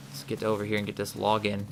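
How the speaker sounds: ordinary talk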